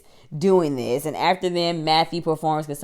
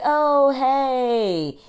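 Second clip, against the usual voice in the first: fake casual; 8 semitones higher than usual. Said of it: "pretend like she don't remember".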